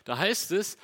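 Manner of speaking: ordinary talk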